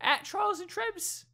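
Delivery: high mock cockney urchin; terrible cockney accent. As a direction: sell the podcast like a cheeky London orphan. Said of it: "my terrible accent".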